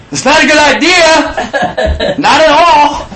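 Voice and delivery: high, slow, laughing no; laughing protest. Retold or imitated. Dad laughs off his son's Santa idea, high and slow. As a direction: laugh through a panicked refusal. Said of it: "I'm like".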